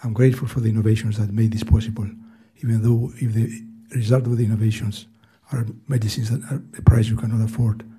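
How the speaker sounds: usual speaking voice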